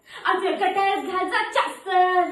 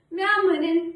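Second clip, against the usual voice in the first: ordinary talk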